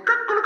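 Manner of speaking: loud, very high cock-a-doodle-doo; rooster crow. Retold or imitated. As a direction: crow like a rooster, long drawn final note. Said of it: "En dan hoort je". (luid, langgerekt hanengekraai)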